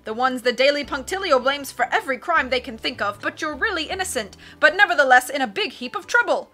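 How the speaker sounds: loud, emphatic captain's chatter; boisterous sea captain, excited. Acted. Captain recognising the three Baudelaires, boisterous and excited. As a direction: charge on with punched exclamations.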